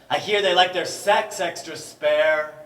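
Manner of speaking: loud, high declaiming shout. Acted. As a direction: hurl the line out loud. Shouted line before quiet echo.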